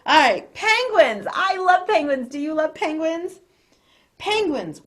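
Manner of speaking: high, loud gushing about penguins; excited. Real, not acted. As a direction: gush with delight.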